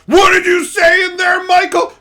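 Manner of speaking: loud, high shout from another room; shrieking domineering wife; mock angry wife. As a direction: holler at your husband accusingly. Wife overhears muttering husband.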